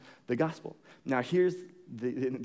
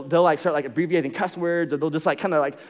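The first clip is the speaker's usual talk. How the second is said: normal talking voice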